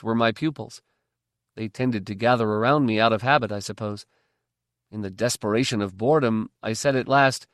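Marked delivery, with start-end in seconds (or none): none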